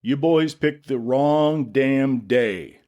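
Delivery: slow, steady, commanding; calm authority. A narrator's reading. Jack warns the intruders with calm authority. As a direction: let it carry down the ridge, calm. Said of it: "his voice carrying down the ridge with calm authority".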